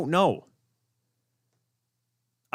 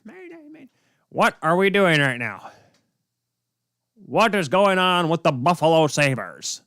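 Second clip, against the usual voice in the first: a modest imitation of an excited play-by-play caller; mock despairing broadcaster cry; Rick Jeanneret impression. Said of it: "that's my best Rick Jeanneret impression".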